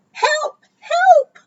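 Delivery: very high, yowling cry for help; panicked meow. Acted. Scared Cat yowls for help at the burrow, a high panicked meow. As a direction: wail it like a frightened cat. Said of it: "meowed Cat".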